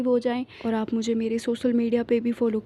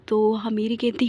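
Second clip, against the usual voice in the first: usual speaking voice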